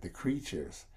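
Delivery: "the creatures" repeated softly with a hint of Spanish accent; quiet Spanish-accent echo. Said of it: "just the way he said it".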